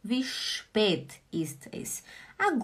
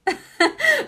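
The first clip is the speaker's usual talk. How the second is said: high laugh; 8 dB louder than usual. Real, not acted. After acting out the husband scene.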